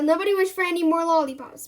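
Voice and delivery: loud, fast fed-up order; yelling. Acted. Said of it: "I yell".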